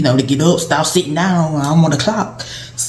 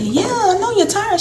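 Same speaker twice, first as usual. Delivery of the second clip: a much higher, light teasing answer; high teasing reply. Retold or imitated. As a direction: tease her gently, up high. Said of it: "And I was like".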